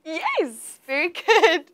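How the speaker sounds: high, laughing "very good"; delighted laugh at a right answer; laughing praise. Real, not acted. Teacher delighted by correct answer.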